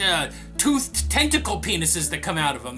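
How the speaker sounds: usual speaking voice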